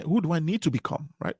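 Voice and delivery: ordinary delivery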